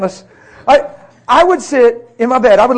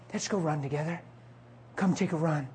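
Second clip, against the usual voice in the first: very soft, low nudge; hushed and close; intimate invitation; 4 semitones lower, 17 dB quieter than usual. Retold or imitated. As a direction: murmur a gentle early-morning invitation. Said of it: "It's so personal and intimate".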